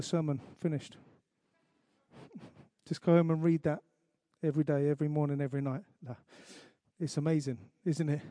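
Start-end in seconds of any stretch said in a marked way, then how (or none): none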